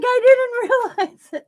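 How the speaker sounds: high, loud squeaky friend voice; friend's mortified confession, voiced high; dismayed friend. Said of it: "she's like".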